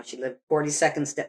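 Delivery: ordinary delivery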